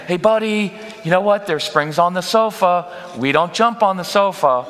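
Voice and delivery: high, gentle sing-song; sing-songy mommy tone. Shown as a demonstration. Parent coaxing a child off the sofa, high and sweet. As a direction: coax the kid sweetly, up high. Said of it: "do the "we" thing".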